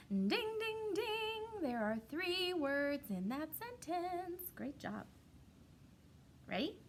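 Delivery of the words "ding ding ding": high sung ding ding ding; bell ding. Acted. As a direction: chime it like a quiz bell. Teacher rings out right answer.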